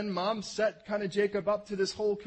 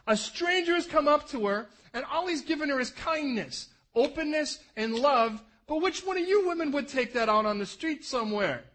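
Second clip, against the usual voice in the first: ordinary delivery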